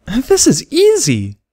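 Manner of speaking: put-on very high, slow, eager little girl voice; high delighted childlike cry; excited filly. A narrator's reading. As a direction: crow it with a full mouth. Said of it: "she cried as she chewed".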